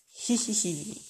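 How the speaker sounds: acted gloating hihihi; mock evil titter; villainous giggle. A narrator's reading. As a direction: snicker after a threat. Villain owl cackles after threat.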